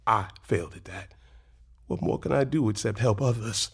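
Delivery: low, soft and defeated; quiet despair. Acted. Husband confessing his failure, low and soft. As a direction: let it sink, barely voiced.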